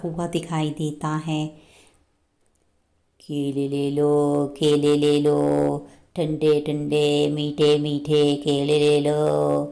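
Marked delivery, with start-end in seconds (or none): 3.2-9.6 low, sing-song street vendor's cry; केले वाले की पुकार. Acted. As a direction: call out bananas for sale, low and drawn out. Banana seller calling out his wares. (फेरीवाले की भारी, लयदार पुकार)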